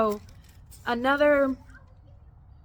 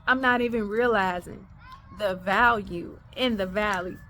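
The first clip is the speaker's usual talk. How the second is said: normal talking voice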